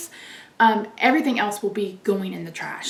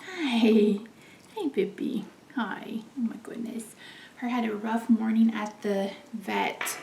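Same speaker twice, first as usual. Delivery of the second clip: soft tender whisper; 6 dB quieter than usual. Real, not acted. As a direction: whisper sweetly to the cat. Whispering to the cat.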